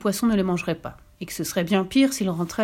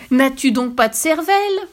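loud, higher outraged question; angry shouting; 5 semitones higher, 6 dB louder than usual. A narrator's reading. Wife vociferates at her husband, angry shouting. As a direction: shout it as a scolding. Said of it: "vociféra-t-elle".

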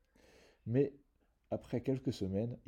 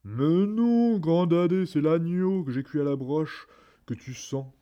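much higher, very loud coaxing giantess voice; 7 semitones higher, 10 dB louder than usual. A narrator's reading. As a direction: brush off her husband in a booming high voice. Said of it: "la géante répondit".

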